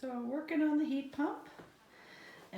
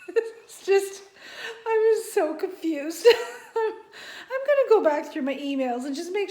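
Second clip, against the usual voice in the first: higher, louder, laughing near tears; helpless laughter; 7 semitones higher, 7 dB louder than usual. Real, not acted. Still baffled by the locksmith, laughing till near tears. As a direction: talk through helpless laughter. Laughing over the lock mix-up.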